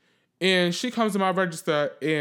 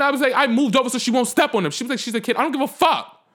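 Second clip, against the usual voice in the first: high, animated retelling; worked-up rant; 6 semitones higher than usual. Retold or imitated. Recounting the lady who stepped on her wraps, worked-up rant. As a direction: get heated re-telling a run-in. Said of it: "That was a lot".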